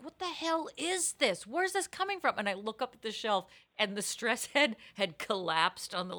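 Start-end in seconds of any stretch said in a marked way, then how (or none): 0.0-2.3 high baffled outburst; sudden confused alarm, pitched high; surprised. Retold or imitated. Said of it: "I was like".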